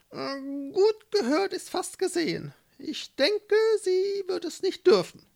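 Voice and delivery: very high-voiced, doubtful mole; skeptical mole. A narrator's reading. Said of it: "Manni war skeptisch". (skeptischer Maulwurf, sehr hohe Stimme)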